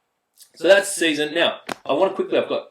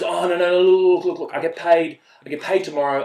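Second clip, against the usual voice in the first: pleading excuse; 5 semitones higher, 4 dB louder than usual. Retold or imitated. Mimicking teammate's excuses.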